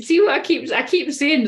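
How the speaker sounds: ordinary delivery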